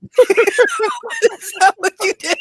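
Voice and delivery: high, slow words through helpless laughter; can barely get it out for laughing; laughing hard. Real, not acted. Cracking up at misheard word.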